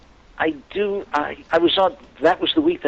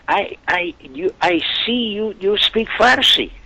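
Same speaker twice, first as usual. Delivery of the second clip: put-on heavy Iranian accent; 8 semitones higher than usual. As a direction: imitate a heavy Iranian accent, higher-pitched. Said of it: "in a heavy accent".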